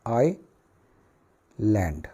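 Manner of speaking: "आइलैंड" split slowly into आइ, long pause, लैंड; syllable-by-syllable pronunciation. Shown as a demonstration. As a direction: say "आइलैंड" very slowly in two separate halves. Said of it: "इसको आइलैंड बोलते हैं".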